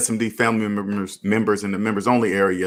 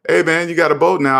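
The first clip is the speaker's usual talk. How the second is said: higher, breezy hey man; casual greeting; 8 semitones higher, 4 dB louder than usual. Retold or imitated. Greeting a friend who just bought a boat, breezy and higher. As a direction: keep it light and pitched up. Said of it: "So I was like".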